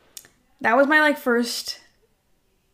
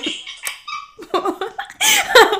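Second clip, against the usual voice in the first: high, wheezy fit of giggles; long high laugh; 13 semitones higher, 4 dB louder than usual. Real, not acted. Laughing before admitting she cried, high giggles.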